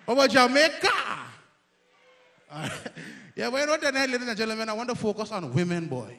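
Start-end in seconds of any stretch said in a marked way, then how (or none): none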